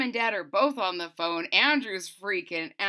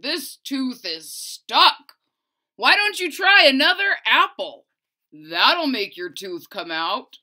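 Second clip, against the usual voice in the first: father's voice; 2 semitones higher, 8 dB louder than usual. A narrator's reading. Father urges his son to try another apple. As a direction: talk the boy into another bite.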